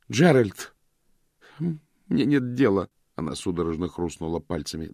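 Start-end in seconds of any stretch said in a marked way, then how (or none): 0.1-2.9 scornful outburst. Acted. Vivien snaps dismissively.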